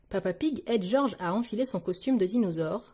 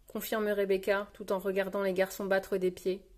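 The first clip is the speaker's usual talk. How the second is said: ordinary delivery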